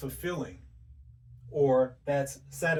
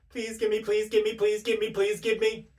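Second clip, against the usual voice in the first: pleading prayer, much higher and louder; desperate begging prayer; 8 semitones higher, 4 dB louder than usual. Retold or imitated. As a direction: beg God over and over like a desperate victim. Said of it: "Sounds like desperation".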